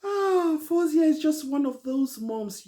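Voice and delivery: loud, high exclamation after a laugh; amused outburst. Real, not acted. Host reacting to Fozia after laughing, amused outburst. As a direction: burst out with real delight.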